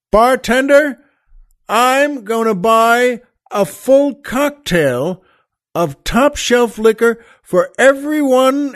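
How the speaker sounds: slow bellowed order that spits out the snub. Retold or imitated.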